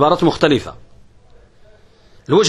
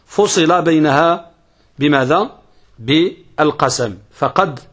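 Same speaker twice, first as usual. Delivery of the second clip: ordinary talk, nothing marked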